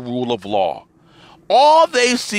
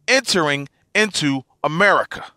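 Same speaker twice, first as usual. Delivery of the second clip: usual speaking voice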